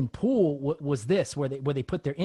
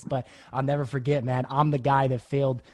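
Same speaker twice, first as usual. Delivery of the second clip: ordinary delivery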